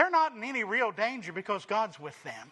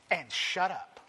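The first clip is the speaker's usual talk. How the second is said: blunt aside; 3 semitones lower than usual. Retold or imitated. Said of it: "That's what he said".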